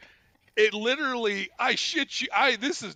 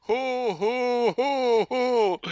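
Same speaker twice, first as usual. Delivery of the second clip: raised "ho ho ho ho" imitation; bellowing laugh; 5 semitones higher, 3 dB louder, much slower than usual. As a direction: hammer out a mock belly laugh. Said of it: "has this big bellow of a laugh".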